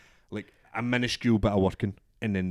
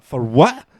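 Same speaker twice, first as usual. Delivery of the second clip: loud, rising retort; mock-outraged protest shooting up at the end; indignant disbelief; 5 semitones higher, 12 dB louder than usual. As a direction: throw it back, incredulous. Retold protest at suspension news.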